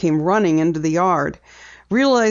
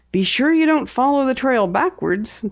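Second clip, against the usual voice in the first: higher, lilting playful jibe; teasing; 7 semitones higher than usual. A narrator's reading. Daughter teases father about detective work, playful. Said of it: "Penny teased".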